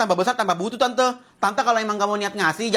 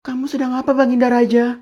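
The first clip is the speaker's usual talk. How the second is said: mock courtly plea; 4 dB louder than usual. Mock-formal pleading to king.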